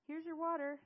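high, loud call; yelling. Acted. Seth running up with water, yelling. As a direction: call it out across a distance. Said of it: "Seth yelled as he ran".

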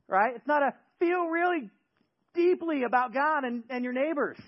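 high, loud impassioned sermon; fervent preaching. Acted. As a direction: preach it with full fire. Preaching at full force.

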